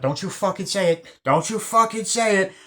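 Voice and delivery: high, loud threat that builds; mock angry threat. [name] warning the guy off, building angry threat.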